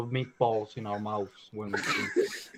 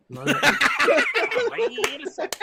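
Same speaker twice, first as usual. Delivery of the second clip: usual speaking voice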